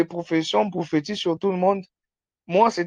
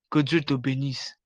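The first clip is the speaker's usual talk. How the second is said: usual speaking voice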